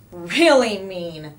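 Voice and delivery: slow, loud stress on really; drawn-out "Really mean"; emphatic agreement. A narrator's reading. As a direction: lean hard on "Really". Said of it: "they all agreed".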